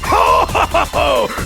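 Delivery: booming "ho ho ho" chortle; theatrical evil belly laugh; pleased villain laugh. Acted. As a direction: laugh smugly as they take the bait. Villain pleased challenge is accepted.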